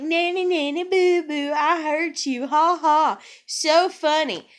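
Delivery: high sing-song mocking jeer; childish taunt. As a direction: taunt like a kid on the playground, slowing at the end. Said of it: "whenever they try to pull that, it's like".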